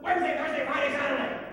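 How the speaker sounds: loud, high bellow rattling off the days; shouting. A narrator's reading. Grumplebuffin interrupts the fairy music, shouting at full volume. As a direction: shout as loud as you can. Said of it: "he shouted as loud as he could".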